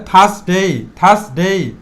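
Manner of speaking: থার্সডে as "thas-dei", r left out; silent r. Shown as a demonstration. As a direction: leave out the r in থার্সডে, stretch the ending. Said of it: "আরটা silent থাকবে". (থার্সডে "র" ছাড়া, "থাসডেই")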